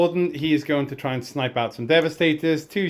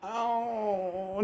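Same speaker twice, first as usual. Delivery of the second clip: rising into a high, drawn-out wail; anguished wail; 10 semitones higher, much slower than usual. Real, not acted. Laments a failed charge roll.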